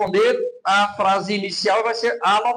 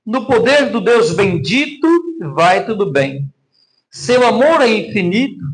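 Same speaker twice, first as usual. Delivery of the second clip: louder, recited hymn lines; hymn reading; 5 dB louder than usual. Acted. Pastor reading the hymn to the congregation, recited. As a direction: read the verses out to the church. Reads hymn stanza aloud.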